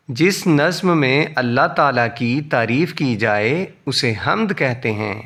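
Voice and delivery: "جس نظم میں" run together at a brisk pace; fluent reading. Shown as a demonstration. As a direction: join the whole sentence from "جس" without word gaps. Same sentence read fluently.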